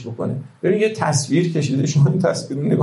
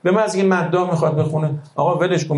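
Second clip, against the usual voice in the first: ordinary talk, nothing marked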